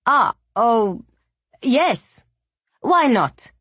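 hesitant, halting yes; stammering. Acted. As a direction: stammer it out awkwardly. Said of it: "he finally managed to stammer".

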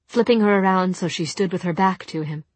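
usual speaking voice

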